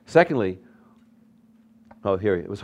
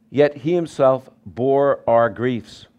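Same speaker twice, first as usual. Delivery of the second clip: slow scripture reading; 3 semitones higher, 5 dB louder, slower than usual. Shown as a demonstration. Pastor reading Isaiah to the congregation, slow and solemn. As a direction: read it slowly, weighty and solemn. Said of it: "It says".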